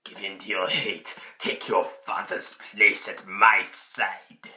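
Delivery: sinister Emperor imitation. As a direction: coax him darkly and slowly. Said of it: "the Emperor's like".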